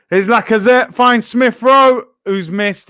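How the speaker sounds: loud, high-pitched match call; excited commentary. Real, not acted. Football streamer calls a missed chance to viewers, excited commentary. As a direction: raise the voice as the chance unfolds, then ease off.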